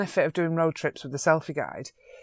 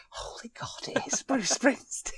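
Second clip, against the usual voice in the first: hushed whisper rising into a high, laughing squeak; stunned realisation; 6 semitones higher, 4 dB quieter than usual. Retold or imitated. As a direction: whisper in disbelief, then break into laughter. Stunned realisation, retold thought.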